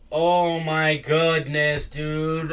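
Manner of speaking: slow, drawn-out exasperation; exasperated. Real, not acted. Streamer struggling to pull up an article, exasperated. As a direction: stretch every word in frustration. Frustrated by a paywalled article search.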